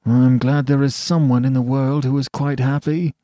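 lightly voiced disappointed onlooker; disappointed mutter. A narrator's reading. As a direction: grumble it with glum envy. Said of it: "muttered a disappointed man".

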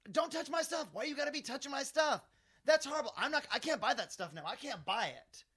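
high-pitched, loud mimicked tirade; outraged shouting. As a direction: act out her shrieked outrage, easing at the end. Lady storms back, outraged at touching.

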